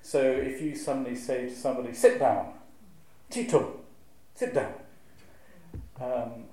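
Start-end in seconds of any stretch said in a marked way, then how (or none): 1.9-4.7 a louder, abrupt "Sit down"; sharp command. Shown as a demonstration. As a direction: snap an order to sit. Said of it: "meaning is caught up with sound".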